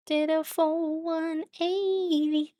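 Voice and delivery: high, slow drawn-out exclaim; a slow, high amazed full one eighty. Real, not acted. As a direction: stretch it out in delight. Streamer exclaiming over sim turn.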